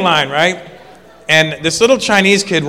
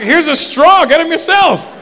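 mock retort; 12 semitones higher, 4 dB louder, slower than usual. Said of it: "I'm like".

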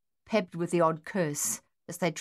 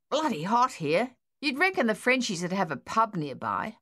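put-on a bored digger grumbling about the heat in a light Australian accent; Australian soldier, lightly voiced; 4 semitones higher than usual.

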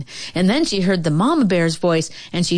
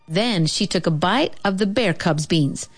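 ordinary delivery, nothing marked

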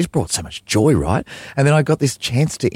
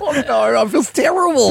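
laughing embarrassment; 13 semitones higher than usual. Real, not acted. Embarrassed at the rude interview.

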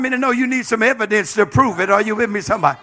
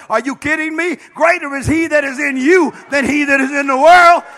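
loud, high preaching at full force; preacher firing up the saints to stop being scared; fired-up preaching; 4 semitones higher, 6 dB louder than usual. Real, not acted. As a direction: rouse the church with a shout. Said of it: "Let me calm down".

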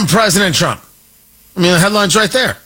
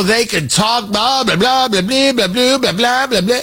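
mocking blah blah; 4 semitones higher than usual. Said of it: "that's how the I R G C talks".

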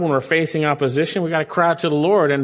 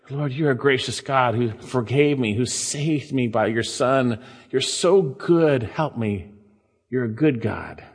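tender prayer; 5 semitones lower than usual. Shown as a demonstration. Preacher models a humble prayer to God, tender and hushed. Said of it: "just to remember for yourself as you pray".

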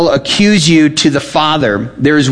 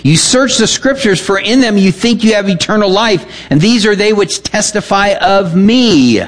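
raised, declaiming scripture voice; scripture reading; 6 semitones higher than usual. Acted. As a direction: proclaim the verses from the pulpit. Reads Jesus' words aloud.

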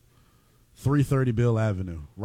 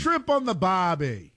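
mock Australian accent; 8 semitones higher, slower than usual. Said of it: "That's an Australian accent".

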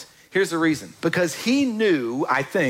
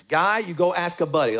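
normal talking voice

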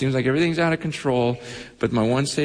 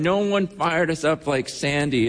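ordinary talk, nothing marked

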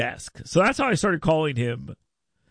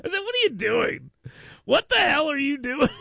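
laughing disbelief; 11 semitones higher than usual. Retold or imitated. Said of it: "I said".

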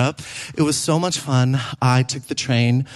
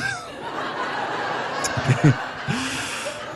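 breathy laugh; 7 semitones higher, 6 dB quieter than usual. Real, not acted. Laughing at own joke.

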